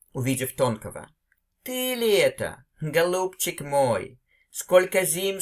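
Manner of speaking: lively read-aloud, voicing the two old friends; expressive story reading. Teacher reading a Chekhov story to her students, expressive and acted. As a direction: read the story with feeling and act out each friend's lines.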